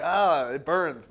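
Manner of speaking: hammed-up slow "it burns"; mock pain. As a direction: act out a burn, stretching the words. Acting out pain of the burn.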